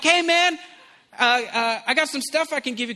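high, loud friendly hail; calling out. Retold or imitated. Street evangelist to man darting away, high loud call. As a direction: call after someone walking away.

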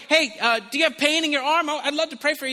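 high, loud pressing plea; eager offer. Retold or imitated. Evangelist offering prayer to departing man, high and loud. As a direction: call out an offer before they leave.

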